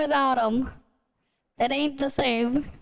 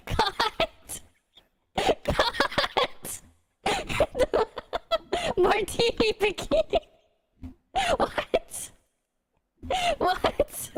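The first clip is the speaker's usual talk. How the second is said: laughing disbelief; 10 semitones higher, much slower than usual. Real, not acted. Cracking up at the clip.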